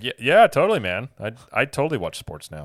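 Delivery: loud, high burst of eager agreement, then settling; mock enthusiasm. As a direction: play along like a pretend sports fan. Playing along as fake sports fan.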